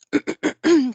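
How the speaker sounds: loud rough throat clear. Real, not acted. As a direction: clear your throat hard. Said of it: "I'm losing my voice".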